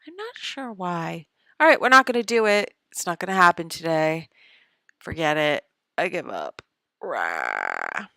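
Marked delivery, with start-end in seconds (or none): 1.6-6.6 loud, fast exasperated outburst; fast loud frustrated declaration; loud giving up. Real, not acted. Giving up loudly. 7.0-8.1 frustrated low growl. Real, not acted.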